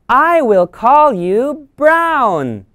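put-on high, slow little-girl voice; name the puppy proudly like a small girl; child naming dog. Jenny naming her new dog, slow and high. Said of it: "she says".

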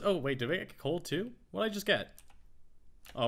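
normal talking voice

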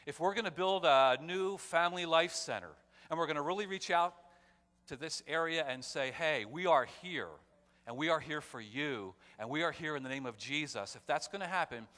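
loud, driving pulpit delivery with hammered words; rally the church like a preacher building up; preaching at full force. Acted. Pastor urging his congregation to reach out, preaching loudly.

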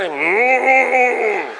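pulsing, drawn-out hum; vibrating hum. Retold or imitated. Mimicking pastors at prayer, vibrating hum. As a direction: hum in waves like a phone vibrating. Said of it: "cuando los veo que hacen".

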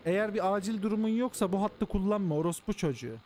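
shouting down the phone at prank callers; mock operator scolding. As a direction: bark it like a fed-up emergency operator.

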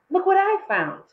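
high, loud eager call; boy proudly calling the others over; excited boy. A narrator's reading. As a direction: show off a find, thrilled. Luke calls out his find.

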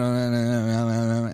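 mock monotone stalling; droning filler sounds. Shown as a demonstration. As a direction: demo a speaker stalling with fillers. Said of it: "and that tone".

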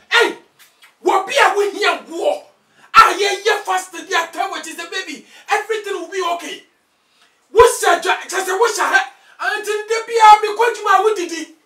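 loud furious yelling at his wife; angry shout. Acted. Husband catches wife breaking the fast, furious shout. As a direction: bellow at her for eating during the fast.

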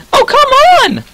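very high cracking squeal of protest; loud squeaked outburst, cracking upward. Real, not acted. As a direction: shout it so high the voice breaks. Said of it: "That was not a voice crack".

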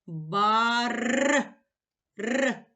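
Бар, then a long rolled р; stretched sound. Shown as a demonstration. Teacher to pupils, isolating each sound: р held long. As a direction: hold the р in Бар as a rolled trill. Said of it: "позначаю кружечком".

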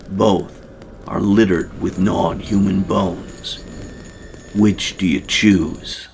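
game master scene description. Shown as a demonstration. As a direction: set the dark scene, slowing toward the question. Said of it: "Watch this description".

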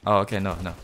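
usual speaking voice

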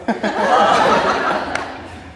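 a loud burst of laughter; laughing about the Batman toy. Real, not acted.

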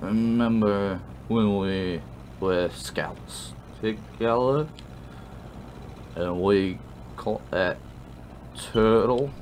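put-on slow, slurred drunk reminiscing; sloppy drunk, groping for the memory. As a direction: drag the words out tipsily. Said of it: "You got sloppy ass drunk".

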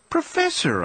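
high shriek of protest. Acted. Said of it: "I shrieked".

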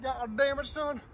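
normal talking voice

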